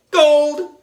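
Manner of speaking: loud, high crowd-style holler; high ringing shout; excited shout. A narrator's reading. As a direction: holler it like a mob of kids. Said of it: "we were all shouting".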